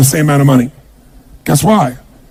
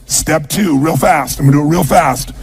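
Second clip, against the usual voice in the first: ordinary talk, nothing marked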